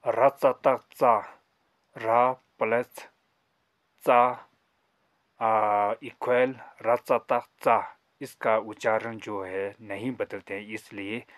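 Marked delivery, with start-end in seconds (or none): 0.1-8.0 रधतकदा, slow and louder, each part stressed; slow loud demo reading. Shown as a demonstration. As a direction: call the syllables out to the class. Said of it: "रचतक चा वही पढ़ते हैं". (धीमे और ज़ोर से रधतकदा पढ़ना)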